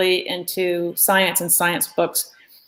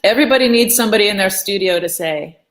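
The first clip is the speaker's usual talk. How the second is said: ordinary talk, nothing marked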